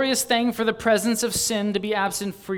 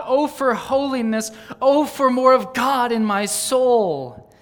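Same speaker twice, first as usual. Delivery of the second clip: higher, louder, pleading cry to God; fervent devotional reading; 6 semitones higher, 6 dB louder than usual. Acted. Preacher reads out a yearning confession to God, fervent. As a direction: plead with longing, stretching the final cry.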